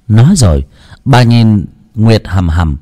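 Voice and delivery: usual speaking voice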